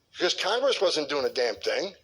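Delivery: normal talking voice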